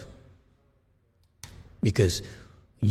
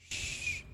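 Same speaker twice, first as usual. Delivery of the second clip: soft, long hissing "shh"; hushed sibilant sucking noise; sucking-in sound; 16 dB quieter than usual. Shown as a demonstration. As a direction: demonstrate drawing it in with a quiet hiss. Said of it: "you have the ability to suck it".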